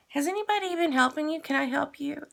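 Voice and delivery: high, fast, bright-eyed clerk voice; chirpy salesgirl. Retold or imitated. New salesgirl greeting the stranded shopper, high and fast. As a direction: rattle it off like an eager shop girl.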